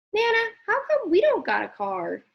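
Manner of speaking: lightly voiced young boy; child voice. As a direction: ask it like a curious boy. Child CJ questioning Nana.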